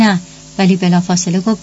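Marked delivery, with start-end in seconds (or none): none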